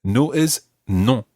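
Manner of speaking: slow, careful model phrase; slow teaching pace. Shown as a demonstration. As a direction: spell it out slowly for learners. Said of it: "slow model for learners".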